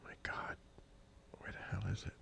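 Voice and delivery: barely audible, whispered grumble; muttering to self. Real, not acted. Searching for a lost file, muttering to self. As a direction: say it under your breath.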